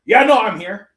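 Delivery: hammed-up sleep talker; imitating sleep-talk. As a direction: blurt it like talking in a dream. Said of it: "intervals of just like".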